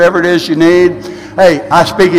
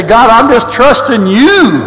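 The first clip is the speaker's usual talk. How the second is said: said more firmly and slowly; louder, with more conviction; 5 semitones higher, 3 dB louder, slower than usual. Shown as a demonstration. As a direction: say it like you mean it. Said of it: "Everybody didn't say that".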